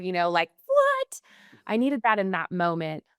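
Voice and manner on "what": shocked high "What?", pitched far up; indignant squeak of disbelief; mock outraged gasp of a word; protective outrage. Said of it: "I needed that protective".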